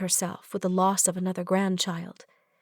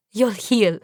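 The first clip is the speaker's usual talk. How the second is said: laughing; 5 dB louder than usual. Acted. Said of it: "laughing even harder".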